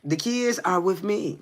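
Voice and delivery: mocking innocence. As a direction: play innocent to taunt the caller. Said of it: "he replied with a mocking innocence".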